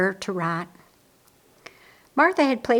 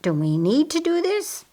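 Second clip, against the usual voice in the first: higher, climbing question; anxious question; 8 semitones higher than usual. A narrator's reading. Mary questions Martha about fetching Hannah, anxious. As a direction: ask it reluctantly, pitch climbing. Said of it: "Mary questioned Martha".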